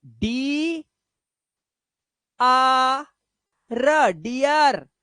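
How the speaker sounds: spelling डियर aloud slowly; slowly sounded out. Shown as a demonstration. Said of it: "क्या करोगे".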